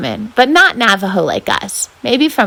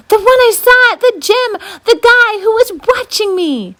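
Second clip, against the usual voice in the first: frightened; 12 semitones higher, 3 dB louder than usual. Acted. Frightened girl.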